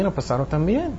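usual speaking voice

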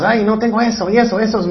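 high mock tantrum; mimicking a whining, demanding child; angry childish adult. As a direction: throw a put-on child's tantrum. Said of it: "Están enojados".

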